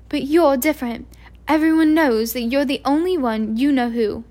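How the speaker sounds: a hint of a brisk, disapproving professor; stern witch complaining. A narrator's reading. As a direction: scold the careless crowds. McGonagall voiced in story.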